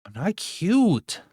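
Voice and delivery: high, slow squeaky cutesy whine. Acted. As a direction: pout it out in a tiny cute voice. Insisting she is not cute.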